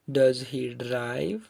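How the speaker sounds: very slow, drawn-out example question; deliberate teacher's drill delivery; model question. Shown as a demonstration. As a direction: draw out each word for learners.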